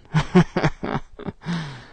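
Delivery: twisted, scornful laugh; distorted laugh. A narrator's reading. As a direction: laugh mockingly at him. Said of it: "Er lacht verzerrt".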